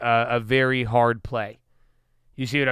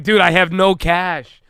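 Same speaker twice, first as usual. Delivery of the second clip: louder, higher, slow and fed up; exasperated retort; 5 semitones higher, 6 dB louder than usual. Retold or imitated. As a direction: snap back at the chat, fed up. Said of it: "I'm like".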